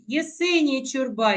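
usual speaking voice

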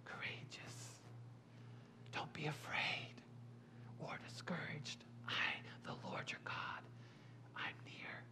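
soft, intimate whisper; whispering as God. Acted. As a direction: speak God's comfort in a bare whisper. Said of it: "God whispers".

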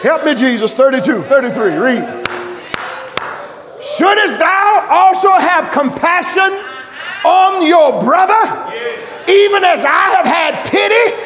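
loud, high whooping preacher in full flight; fiery revival sermon climax; preaching at full force. Acted. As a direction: shout it to the whole congregation.

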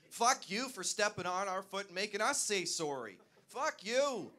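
loud, building comic tirade; mock furious rant. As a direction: let the polite Canadian blow up, ending in a high shout. Mock outburst of what sorry means.

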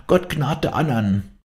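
high, slow cry of a dying woman; shrieked plea. A narrator's reading. Dying woman prays for the others, high drawn cry. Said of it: "kreischend, wie ein Notschrei".

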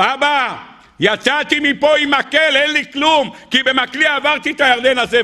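high, impassioned appeal, imitating Jacob; acted pleading. Jacob tells God he left with only a stick, acted pleading. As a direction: appeal upward as a child to a father.

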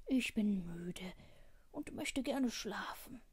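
worn out and ready for sleep; tired dog. A narrator's reading. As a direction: drag the words drowsily.

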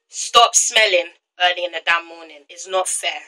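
usual speaking voice

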